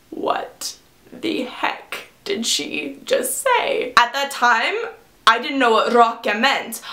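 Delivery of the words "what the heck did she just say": slow, hushed disbelief; stunned whisper. Retold or imitated. Friends behind the curtain reacting to the mistake, stunned slow whisper. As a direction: whisper it slowly, word by word, baffled. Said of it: "they were like".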